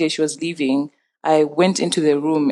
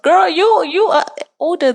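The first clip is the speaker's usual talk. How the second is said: high-pitched, exclaiming protest; hammed-up outraged comeback; mock indignant retort; 14 semitones higher, 3 dB louder than usual. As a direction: throw it back in a squeaky, incredulous pitch. Acted retort in retold quarrel.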